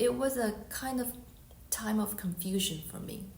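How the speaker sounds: ordinary delivery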